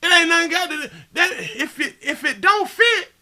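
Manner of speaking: high, loud excited rant; loud high-pitched worked-up ramble. Real, not acted. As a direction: get carried away and yell high.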